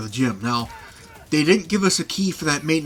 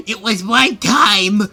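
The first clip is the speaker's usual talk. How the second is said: slow, drawn-out, higher and louder; mad protest; 6 semitones higher, 7 dB louder, much slower than usual. Retold or imitated. Teased kid snapping back at friends, slow and loud. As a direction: insist it, fed up. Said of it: "he'd get mad and go".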